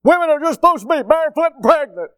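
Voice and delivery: high, loud mock of a sexist saying; preacher parodying what idiots say; mocking the culture. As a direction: sneer it out like an idiot would say it. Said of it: "We say".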